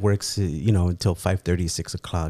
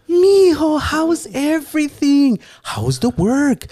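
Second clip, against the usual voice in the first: mom's joyful high greeting; 14 semitones higher than usual. Retold or imitated. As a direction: gush like an overjoyed mom hearing her son. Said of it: "she's saying it in that tone".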